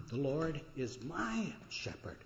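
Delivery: slow line with "my" drawn out and emphasized; stress on "my". Retold or imitated. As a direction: linger meaningfully on "my". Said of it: "with tear-filled eyes".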